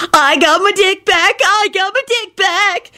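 very high, slow crowing; gleeful cackle. A narrator's reading. Kenny dancing about with his news, cackling glee. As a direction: crow it out in ecstasy. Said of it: "he cackled".

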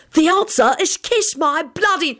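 high-pitched defiant holler. A narrator's reading. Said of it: "just scowled and hollered out".

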